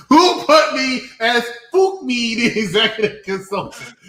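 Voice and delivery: high-pitched, laughing protest. Real, not acted. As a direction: ask it through laughter, incredulous. Laughing over changed screen name.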